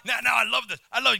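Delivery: ordinary talk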